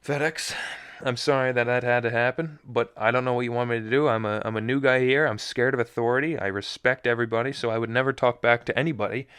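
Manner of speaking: ordinary delivery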